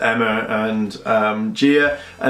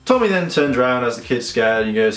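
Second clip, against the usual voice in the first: ordinary talk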